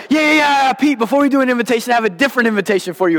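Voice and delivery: high, rushed call-out; urgent interruption. Retold or imitated. Young staffer interrupts Pete before the invitation, high and hurried. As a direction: cut in fast before he can go on. Interrupting the preacher mid-service.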